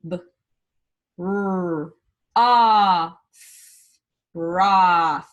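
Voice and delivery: B, r, a, th said apart; segmenting sounds. Shown as a demonstration. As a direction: break broth into its sounds. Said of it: "break these words down into sound".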